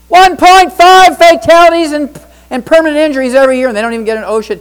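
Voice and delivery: loud, high, indignant; outraged shout. Real, not acted. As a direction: hammer it home, outraged. Outraged repetition of statistic.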